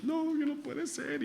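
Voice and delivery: high, thin tearful quote, slowed and soft; imitating crying relative. Preacher retelling a relative's tearful phone call, a high soft mock sob. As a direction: echo the relative's sobbing protest, mildly. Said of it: "me llamó un familiar llorando".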